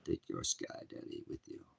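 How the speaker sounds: a sneering whispered aside; whispered scorn. Real, not acted. Preacher dismissing sky daddy believers in a sneering whisper. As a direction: whisper it with contempt.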